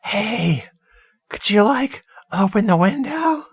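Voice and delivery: high, sing-song creepy ghoul voice; a high, wheedling ghoul at the window. Retold or imitated. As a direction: coax her to open the window like a lurking ghoul. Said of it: "The ghoul's like".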